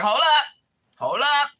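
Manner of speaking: high, loud retold interruption; indignant halt. She stops coworker assuming extensions, indignant halt.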